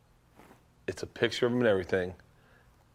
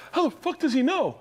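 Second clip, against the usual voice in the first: high-pitched mock amazement; imitated astonished daughter. Daughter amazed on the phone to Santa, mock high astonishment. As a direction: squeak it out in disbelief. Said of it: "And she'd be like".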